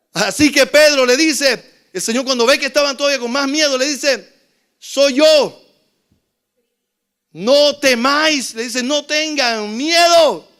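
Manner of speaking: loud, high-pitched sermon storytelling; fiery preaching. Acted. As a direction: preach it at full force to the congregation. Preaching at full force.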